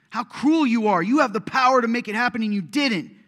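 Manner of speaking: high, loud, accusing cry; bitter outcry. Pretend. Believer accusing Jesus of cruelty, high furious cry. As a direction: throw the blame at God with full force.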